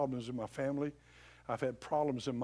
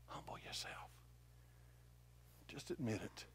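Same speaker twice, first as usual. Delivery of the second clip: very soft, near-whispered urging; hushed appeal; 5 semitones lower, 16 dB quieter than usual. Real, not acted. Preacher urges listeners to humble themselves, hushed appeal. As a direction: drop right down and plead quietly.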